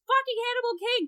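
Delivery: high, loud mock screech; hammed-up furious shriek; imitated scream. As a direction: scream it in exaggerated rage. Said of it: "she just screams".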